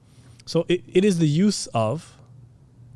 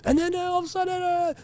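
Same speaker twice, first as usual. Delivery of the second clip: sudden very high preacher voice; shrill weird voice. Shown as a demonstration. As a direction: leap up into a shrill parody voice. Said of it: "a very shrill, weird voice".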